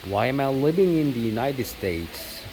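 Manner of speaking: ordinary delivery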